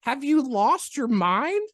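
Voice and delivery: loud, high, drawn-out disbelief; incredulous scolding. Retold or imitated. Chiropractor retelling how they scolded the runaway patient, incredulous.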